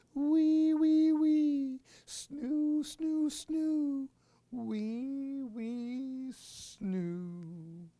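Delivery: high, fading snore chant; high snoring. Acted. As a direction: snore it squeakily then drift off. Baby mummy snoring.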